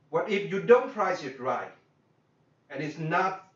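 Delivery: ordinary talk, nothing marked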